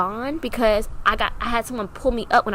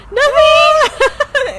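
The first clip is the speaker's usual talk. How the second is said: loud, high wailed denial; hammed-up sobbing squeal; mock crying; 11 dB louder than usual. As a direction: imitate bursting into tears mid-word. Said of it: "Stop crying".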